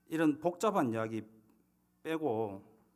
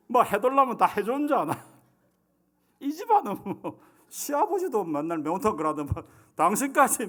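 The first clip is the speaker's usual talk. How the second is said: loud, higher mock wife rant; nagging wife; 4 semitones higher, 5 dB louder than usual. Wife scolding husband about wanting maeuntang, loud mock nagging. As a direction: scold the husband over the spicy fish stew. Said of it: "뭐 이렇게 해야 되는데".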